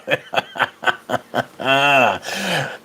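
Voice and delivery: long chuckling laugh; amused laughter. Real, not acted. Streamer tickled by a most northern bonjour, laughing. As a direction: laugh in a run, then trail out.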